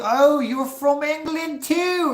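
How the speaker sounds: very high, loud, drawn-out mock English accent; hammed-up English accent; mimicked English accent. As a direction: imitate an English speaker in an exaggerated high voice. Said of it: "Suddenly [name] has an accent".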